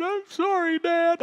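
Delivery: put-on high child's voice, near tears; a little girl pleading her dad; tearful daughter. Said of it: "her chin began to quiver".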